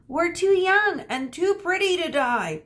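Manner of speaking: high, loud hammed-up whine; mock sobbing. Perseus whining to the rescuers, hammed-up sobbing plea. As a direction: wail it like a spoiled hero. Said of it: "Perseus sobbed".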